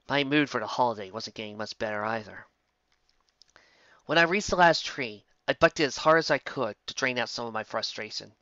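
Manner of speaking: usual speaking voice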